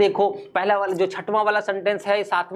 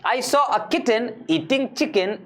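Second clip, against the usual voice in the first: very slow, each word pulled out cleanly; slow, word-by-word careful recital; slow and clear; 3 semitones higher, much slower than usual. Shown as a demonstration. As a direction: say every word carefully one by one. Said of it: "एक एक शब्द को सही से निकालो".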